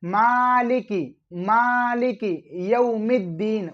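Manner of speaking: slow मालिकी with a long first vowel; slow teaching model of मालिकी यौमिद्दीन; corrected reading. Shown as a demonstration. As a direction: stretch मालिकी, then a clear m in यौमिद्दीन. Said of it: "पहली आप आयत को सुधारें".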